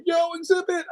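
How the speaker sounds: high, bright call at the door; excited greeting. Retold or imitated. Guy opening the door to [name], excited call. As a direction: greet him like a hyped fan.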